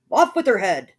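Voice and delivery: loud royal roar; loud furious royal command. A narrator's reading. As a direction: bellow the order. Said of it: "the prince roared back".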